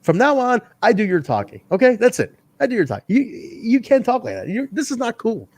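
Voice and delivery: high, scolding know-it-all voice; mock condescending wrestler. Siaki scolds Young in a high, mock condescending voice. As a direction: talk down to him like a smug boss. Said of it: "he goes".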